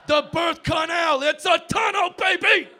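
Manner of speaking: slow then shouted big finish; excited yell. Acted. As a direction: sell the punchline like a hype man. Comic climax about birth canal.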